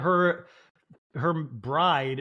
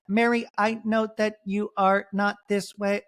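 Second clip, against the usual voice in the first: mocking tiny little voice, higher and carefully spaced; Whitney's tiny voice; 4 semitones higher than usual. Host imitating a housewife confronting Mary, tiny voice. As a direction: put on a tiny, timid-sounding voice. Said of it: "she just has such a tiny voice".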